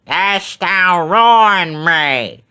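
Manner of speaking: very slow, loud ghostly wail; shrieking witch's ghost. A narrator's reading. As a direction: stretch each word like a witch. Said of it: "shrieked the ghost".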